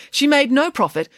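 usual speaking voice

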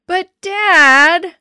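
high, loud, drawn-out whine; whining. A narrator's reading. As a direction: whine at dad like a thwarted kid. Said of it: "I whined".

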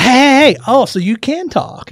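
high, drawn-out "hey" of discovery; mock surprised teasing. Obnoxious rider to silent passengers who finally talk, mock surprised. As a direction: tease them for finally speaking.

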